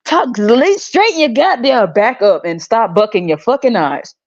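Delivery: normal talking voice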